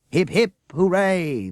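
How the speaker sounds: loud slow high cheer; the crowd screams its hooray. A narrator's reading. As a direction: roar it like a crowd. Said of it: "the crowd screamed".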